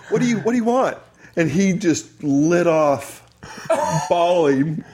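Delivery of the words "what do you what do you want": startled high question. Retold or imitated. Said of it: "and I go".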